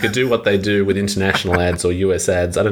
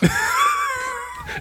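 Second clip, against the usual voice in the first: high-pitched laughing fit; 4 dB quieter than usual. Real, not acted. Listener delighted by the movie theater dub, laughing.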